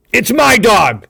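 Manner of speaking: loud, high defiant snap; angry retort. A narrator's reading. Hal defending his claim to Thornton, loud angry retort. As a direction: throw it back at him. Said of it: "Hal replied".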